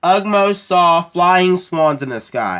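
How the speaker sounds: loud, slow, proud answer to the quiz; announcing the answer to the quiz, loud and slow; called-out answer. Real, not acted. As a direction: call it out slowly so the whole room hears.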